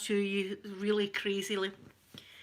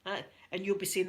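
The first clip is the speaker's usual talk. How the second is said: ordinary talk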